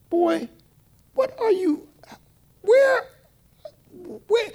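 put-on high, startled scold of a boy; shocked scolding. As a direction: catch him mid-curse, aghast. Scolding a cursing kid.

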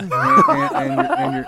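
cracking up mid-word; laughing. Real, not acted. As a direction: lose it laughing mid-sentence.

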